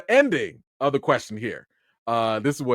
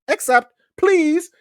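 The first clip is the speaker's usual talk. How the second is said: mock pleading; 6 dB louder, slower than usual. Begging to get the roundtable.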